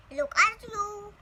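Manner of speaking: slow, smiling adoring words; adoring papa. Acted. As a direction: marvel at the baby fondly. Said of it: "smiling adoringly".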